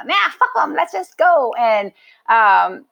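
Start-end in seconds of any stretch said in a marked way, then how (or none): 0.0-1.5 high, dismissive imitation of a friend; retold brush-off. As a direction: wave it away in a high voice.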